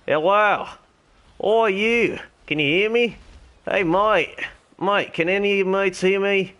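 high-pitched, hammed-up Australian accent, calling out; Ringo the Dingo. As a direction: hail strangers in a broad mock Australian accent. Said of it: "my terrible Australian accent".

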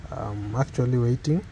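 ordinary delivery

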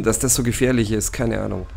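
ordinary talk, nothing marked

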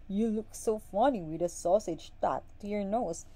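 laughing. A narrator's reading. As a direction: tease the wife through laughter. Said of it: "he laughed".

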